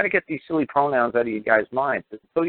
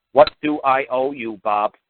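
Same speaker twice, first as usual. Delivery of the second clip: slow, deliberate, one word at a time; slow, blunt model line for the letter; plain simple demo; 3 semitones higher, 3 dB louder, slower than usual. Shown as a demonstration. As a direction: spell it out slowly, man to man. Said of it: "None of this Q-sip".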